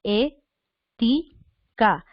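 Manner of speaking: E, ti, ca with a pause after each; syllable by syllable. Shown as a demonstration. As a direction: dictate E... ti... ca in three beats. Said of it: "la fórmula correcta de separar".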